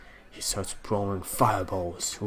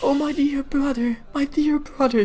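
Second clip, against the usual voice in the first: much higher, louder voice breaking into tears; crying sister; 8 dB louder than usual. Acted. Seraphim weeping over her dying brother, high and breaking. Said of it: "She is crying heavily".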